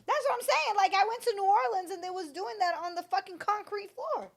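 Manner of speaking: worked-up disbelief; excited. Real, not acted. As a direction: pitch it up, can't believe it. Insisting magic happened on concrete.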